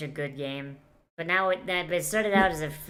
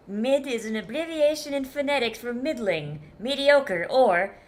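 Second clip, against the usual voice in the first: higher, louder mock definition read; reading chat aloud; 6 semitones higher, 5 dB louder than usual. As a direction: read it like a dictionary entry. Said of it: "That chat's just explaining mid".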